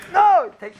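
hammed-up stern mommy bear; firm mother bear. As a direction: grab the baby back firmly. Mommy bear takes the baby.

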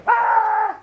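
high loud mock baby cry; hammed-up baby bear scream; baby wail. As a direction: wail like a crying bear cub. Crying baby bear imitated.

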